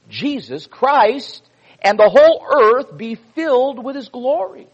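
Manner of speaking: put-on slow, loud pulpit reading of an old text; fervent preacher's delivery; preaching a quote. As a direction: proclaim it to the congregation like scripture.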